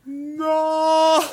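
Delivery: long, high, loud slow-motion "no"; slow-motion cry. Retold or imitated. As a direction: stretch it into a mock horrified slow-motion yell. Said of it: "I see it in slow motion".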